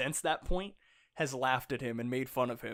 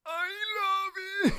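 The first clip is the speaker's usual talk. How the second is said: gushing squeal; 3 dB louder than usual. Pretend. Fan echoing co-host's love of the scene, high gushing squeal. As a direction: squeal it with hammed-up adoration.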